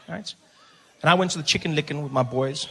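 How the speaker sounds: ordinary talk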